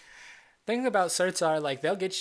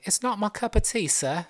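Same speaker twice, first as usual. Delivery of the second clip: mock British accent, pitched up; Richard; 7 semitones higher, 4 dB louder than usual. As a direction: put on a playful British imitation. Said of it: "As Richard would say".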